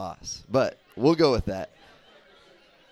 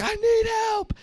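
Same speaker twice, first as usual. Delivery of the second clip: much higher still, a thin pleading cry; desperate cry for help; 4 dB louder, slower than usual. Retold or imitated. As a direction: reach up high as if calling into an empty canyon. Same movie line imitated again.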